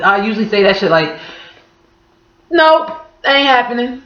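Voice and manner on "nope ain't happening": blunt refusal. Retold or imitated.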